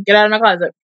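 normal talking voice

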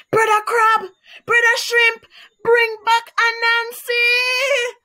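high, loud desperate calls; wailing. Acted. Alligator calling from the beach after the boat, high loud wail. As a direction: call across the water in despair, slowing at the end. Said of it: "wailed Alligator".

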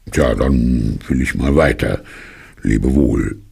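low, reluctant bear; bear character. A narrator's reading. Bear takes leave of his host, low bear voice.

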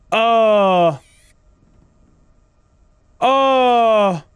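loud high frightened oh; startled scared gasp. Real, not acted. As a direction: jolt at a jump scare. Jump scare in horror game.

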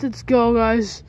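normal talking voice

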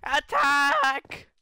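high, drawn-out rallying shout; playful war cry, stretched out; mock battle cry. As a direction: call the troops to charge, loud and high.

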